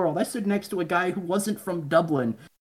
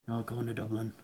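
low, soft, deflated mumble; imitating the gloomy Dublin fan, low and soft; mock mopey voice; 8 semitones lower, 11 dB quieter than usual. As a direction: drag it out like nothing matters. Mocking the sad Dublin guy.

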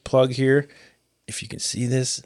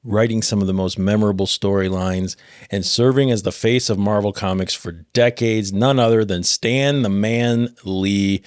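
normal talking voice

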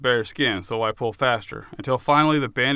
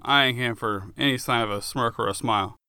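plain reading voice

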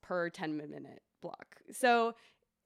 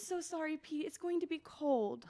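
put-on high little girl voice; a small child apologising sweetly; toddler voice; 10 semitones higher than usual. Toddler quoted again.

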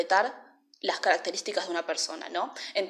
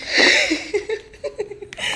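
high, loud villain cackle; evil laugh; 14 semitones higher, 8 dB louder than usual. Acted. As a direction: cackle like a mustache twirling villain. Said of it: "Es una risa malvada".

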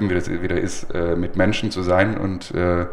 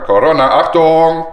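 loud, high mock alarm; warning call; 9 semitones higher, 7 dB louder than usual. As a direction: announce it like a siren warning. Kündigt Thema als Warnung an. (laute Warnung)

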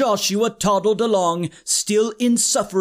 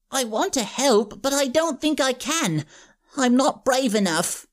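put-on timid kid; 4 semitones higher than usual. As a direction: admit you're scared. Timid classmate confessing.

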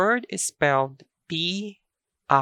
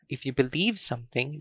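ordinary delivery

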